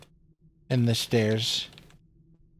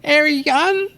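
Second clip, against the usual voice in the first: put-on high squeaky anime girl voice, rising; cute anime girl voice; 13 semitones higher than usual. Shown as a demonstration. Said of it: "like, an anime girl voice".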